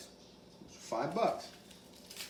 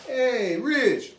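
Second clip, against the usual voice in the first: calling out; 9 semitones higher, 6 dB louder than usual. Retold or imitated. Calling out to cellmate Rich.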